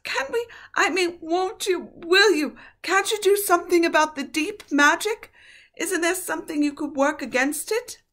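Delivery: put-on anxious child begging; pleading girl. A narrator's reading. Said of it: "whispered Susan".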